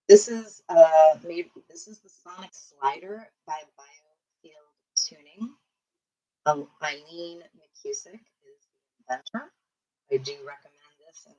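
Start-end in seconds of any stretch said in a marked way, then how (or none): 1.7-9.5 whispering. Real, not acted. Naming a tool while working.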